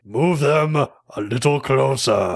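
slow, drawn-out villain order; hammed-up slow command with amusement; movie villain imitation. Quoting a favorite movie part, hammed-up villain imitation. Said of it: "that part where he's like".

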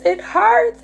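"It hurts" cried out very high; pained high wail; anguished cry. Retold or imitated. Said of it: "I was like".

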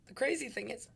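words broken by a burp. Real, not acted. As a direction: say it through a burp. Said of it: "Ooh, just burped".

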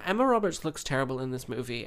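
normal talking voice

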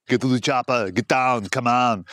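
lightly hammed Austrian movie-hero accent; Arnold voice. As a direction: give the fans their Austrian action-star line. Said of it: "Please do the Arnold impersonation".

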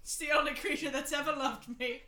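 loud, high manic laughing rant; hammed-up drunk Rufus. Rufus ranting at everyone, loud and manic. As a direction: overact a wrecked dad.

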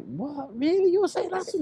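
as if you can't believe your ears; stunned disbelief. Retold or imitated. Disbelief at dad's praise.

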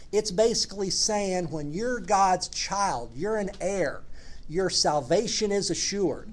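usual speaking voice

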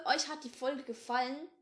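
plain reading voice

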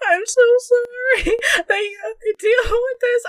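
high, whiny tearful apology; half crying. Retold or imitated. Drunk speaker apologizing to the friend helping her, half crying. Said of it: "I was, like, half crying".

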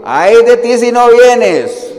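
loud, high, drawn-out threat; threatening. Shown as a demonstration. Preacher showing how inviting Davis turns to threat, loud warning. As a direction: stretch it into a booming warning. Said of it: "wow, ya eso es una amenaza".

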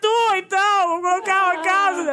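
high, pleading mock whine; whiny plea. Wife retelling begging her husband for clothes, high pleading whine. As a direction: beg him for his clothes, tearful.

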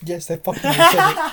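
high, slow, laughing exasperation; laughing admission. Real, not acted. Teased storyteller admits schoolmates said it, laughing exasperation. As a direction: give in through laughter.

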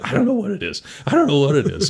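high, loud bewildered panic; panicked coworker. Retold or imitated. Burned coworker telling the storyteller he's lost, high loud panic. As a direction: blurt it out, mock panicked.

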